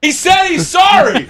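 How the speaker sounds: imitating a cashier shouting the apology to the kitchen; mock shout to the kitchen. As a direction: yell it back to the crew as a parody. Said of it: "and then you hear".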